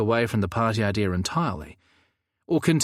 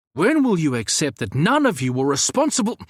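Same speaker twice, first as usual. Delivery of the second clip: higher, louder exasperated plea; exasperated outburst; 6 semitones higher, 4 dB louder than usual. Acted. Samuel exasperated at his friends' guilt, higher and louder. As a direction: throw your hands up and demand it.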